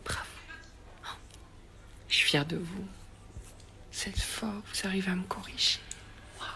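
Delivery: whispered mock praise; sarcastic whisper. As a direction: breathe it like fake admiration. Sarcastic praise to spelling police.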